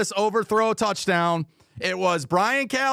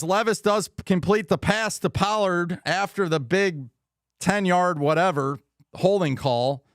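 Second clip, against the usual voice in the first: ordinary delivery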